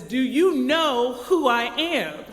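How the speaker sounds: angry traveler raising his voice, dragging it out; furious passenger almost shouting at the gate. A narrator's reading. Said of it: "louder this sa-, time".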